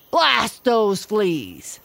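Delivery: loud, slow, furious gorilla curse; raging gorilla. A narrator's reading. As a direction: spit out the curse in a rage. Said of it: "he raged".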